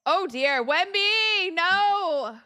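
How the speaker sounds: high loud anguished cry; dismayed cry. Real, not acted. Fan crying out at her star's turnover, dismayed. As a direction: wail his name as the ball goes.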